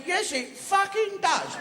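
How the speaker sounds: high, slow indignant rabbit; squeaky rabbit. Acted. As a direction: squeak it out as a fed-up little rabbit. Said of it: "I said".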